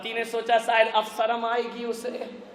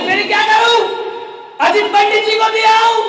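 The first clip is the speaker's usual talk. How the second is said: eager high question; 13 semitones higher, 13 dB louder than usual. Retold or imitated. Woman asks whom to give.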